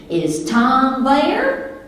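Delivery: drawn-out, high, loud Southern twang. Acted. Wife phoning husband as a made-up character, Southern twang. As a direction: stretch it slowly in a Southern twang. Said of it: "kick in that Southern twang".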